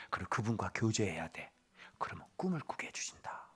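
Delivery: hushed earnest teaching; whispering grandfather. Acted. Grandfather Joseph teaches his grandson to hear God, whispering. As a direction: whisper the secret of dreams to a child.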